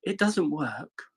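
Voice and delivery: high, slow posh apology; posh Molly impression. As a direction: say it sweetly in a posh voice, unaware of the trouble. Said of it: "And she's like".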